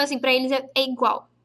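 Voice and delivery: usual speaking voice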